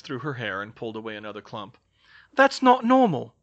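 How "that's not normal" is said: very high, loud outcry; alarmed protest. Acted. Georgina shows another clump of hair, alarmed protest. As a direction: protest loudly, pitched right up.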